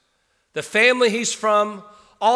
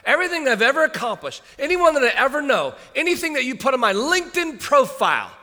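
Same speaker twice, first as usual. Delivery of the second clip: higher, fast, animated Paul listing achievements; acting as Paul; 7 semitones higher, 3 dB louder than usual. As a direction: rattle it off as Paul, emphatic. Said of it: "Paul says".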